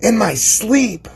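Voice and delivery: a slow, lifted mock-dreamy echo; drawn-out high echo. Shown as a demonstration. Crypto streamer echoing his own boast, slow and high. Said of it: "I can recognize this stuff in my sleep".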